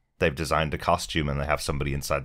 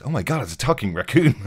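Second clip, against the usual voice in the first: high, louder panicked disbelief; mock astonishment; 8 semitones higher, 6 dB louder than usual. Podcaster imitates Kevin Bacon panicking at Rocket, high and shocked. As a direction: gasp it out in alarm. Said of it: "And he goes".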